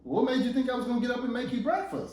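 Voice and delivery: high-pitched indignant retort; incredulous wife. Acted. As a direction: throw the question back in disbelief. Wife retorting in acted quarrel.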